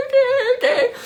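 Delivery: high, panicky imitation; retold hyperventilating. Woman on phone to boyfriend, panicked hyperventilating. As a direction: squeak it out as if short of breath. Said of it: "I'm hyperventilating".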